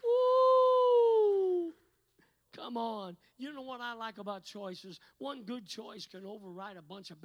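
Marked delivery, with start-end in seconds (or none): 0.0-1.7 loud high preacher's whoop. Real, not acted. Preacher whoops to the congregation, high and loud. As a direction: let out a joyful whoop.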